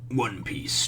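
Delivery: hushed whisper of the title. Shown as a demonstration.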